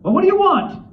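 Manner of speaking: high, loud, irritated challenge; mock-angry outburst at being interrupted; angry demand. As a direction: imitate a scowling author snapping at an intruder. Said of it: "he looked really angry".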